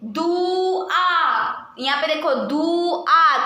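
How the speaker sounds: दुआ stretched very slowly, nasal आ held; drawn-out demo word. Shown as a demonstration. Teacher to class, modelling the nasal vowel, slow दुआ. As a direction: draw out दुआ. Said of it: "आ के पीछे pronounce हो रहा है".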